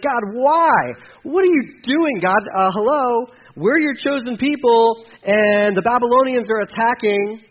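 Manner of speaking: high, loud, pleading frustration; exasperated complaint to God. Retold or imitated. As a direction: whine at God like a wronged child, pushed high and loud. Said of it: "He's like".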